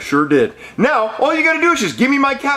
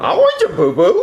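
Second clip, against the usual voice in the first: put-on giggling kid voice.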